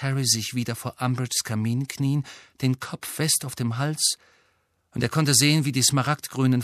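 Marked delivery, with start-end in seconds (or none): none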